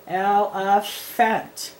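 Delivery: "Elephant" said slowly, each syllable clear with "ph" as F; slow sound-out. Shown as a demonstration. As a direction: spell it out in sound, "El-e-phant". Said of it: "P H makes the F sound".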